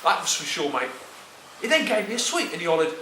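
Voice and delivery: ordinary delivery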